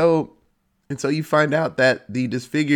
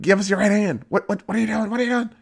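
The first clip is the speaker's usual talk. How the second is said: higher and higher mock cop yelling; panicked cop; 9 semitones higher, faster than usual. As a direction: act a cop losing his nerve.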